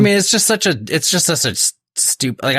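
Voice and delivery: worked-up, exasperated rant; exasperated, stumbling over the words; sounding pissed. Real, not acted. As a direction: start high and sputter, as if pissed off. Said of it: "I know I'm sounding like I'm pissed".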